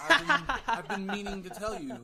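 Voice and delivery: laughing. Pretend. Mock serious revelation, laughing.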